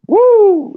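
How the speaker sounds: high, held "woo"; excited whoop. Real, not acted. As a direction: whoop in delight at the collection. Admiring the comic collection.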